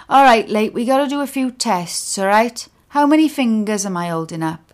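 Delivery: a hint of a chummy paramedic checking a patient; ambulance man. A narrator's reading.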